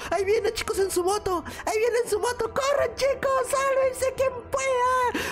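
high, frantic yell; panicked. Acted. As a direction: sound the alarm. Villain arrives on motorbike. (pánico fingido)

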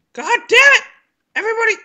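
high, loud exasperated curse; high frustrated yell. Real, not acted. Gamer fed up with his teammates, high exasperated yell. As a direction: lose patience at the whole team.

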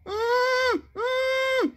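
high gagged humming through bandages; muffled high cry. Acted. Monsieur Malchance crying through bandages, a high muffled hum. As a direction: cry out with the mouth wrapped shut. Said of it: "cria Monsieur Malchance".

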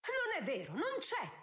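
high-pitched indignant denial; outburst of protest. A narrator's reading. Countess protesting about her wedding ring, indignant. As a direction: deny it, leaping up in pitch. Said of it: "Araminta insorse".

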